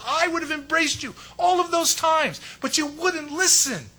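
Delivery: pleading lament. Acted. Jesus pleading with Jerusalem.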